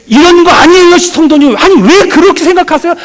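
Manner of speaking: loud, high, hot-blooded arguing; heated remonstrating. Retold or imitated. Pastor re-enacting how he argued with church members, heated remonstrating. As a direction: pour out the heat, imitating an insistent pastor. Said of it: "라고 열기와 헐기를 퍼붓지만".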